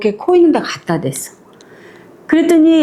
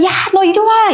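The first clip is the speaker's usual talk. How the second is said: put-on high, sharp call after the delivery boy; mock angry summons; 8 semitones higher than usual. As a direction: yell for the kid to come back. Man calls after the paperboy.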